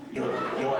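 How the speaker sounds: lightly imitating the old teacher's gravelly voice; a hint of a gravelly Italian Odo jabbing a finger; mock gravelly teacher insisting. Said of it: "he had this gravelly voice, kinda sounded like an Italian Odo".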